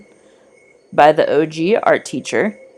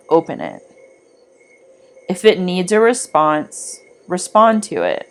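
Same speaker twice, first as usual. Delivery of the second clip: guided meditation. Acted. Guiding listeners back from the Wise One's clearing, calm meditation voice. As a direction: guide the listener calmly back along the forest path.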